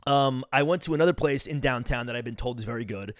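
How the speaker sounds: ordinary talk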